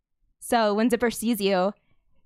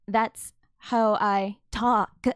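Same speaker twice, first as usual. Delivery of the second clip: slow, halting creature speech; drawn out, word by separate word; creature in borrowed voices; 3 dB louder, much slower than usual. Acted. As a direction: piece the words together one at a time. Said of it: "a lot of different voices".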